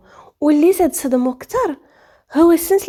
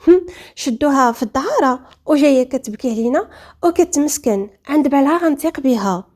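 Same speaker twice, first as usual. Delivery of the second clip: usual speaking voice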